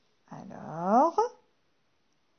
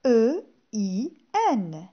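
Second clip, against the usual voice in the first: slow spelled letters; 3 dB louder, slower than usual. Shown as a demonstration. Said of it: "le son un s'écrit avec".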